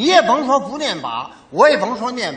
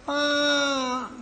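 usual speaking voice

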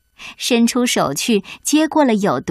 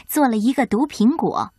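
ordinary delivery, nothing marked

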